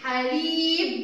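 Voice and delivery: حليب slow with a held "lii"; drawn-out حليب; long vowel. Shown as a demonstration. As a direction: stretch the ya in حليب. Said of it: "مد الياء".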